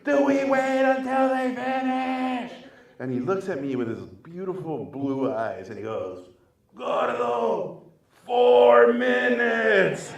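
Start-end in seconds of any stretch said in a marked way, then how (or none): 0.0-2.5 high, drawn-out shout; yelling over noise. Retold or imitated. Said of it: "I yell at Guillermo". 6.8-10.1 high, long-drawn shout; mimicked director shouting over leaf blowers; shouted warning.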